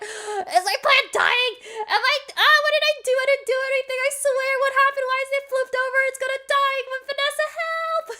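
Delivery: put-on frantic, high panicky boyfriend voice; distressed Evan. Husband panicking over his fallen plant, high frantic voice. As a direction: squeal it out like a man losing his plant. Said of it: "he was so distressed".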